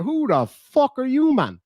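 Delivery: mock indignant. Buzz waking in the coffin, mock indignant. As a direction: stretch it out, offended. Retelling a show scene.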